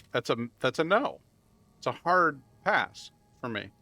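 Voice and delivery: ordinary talk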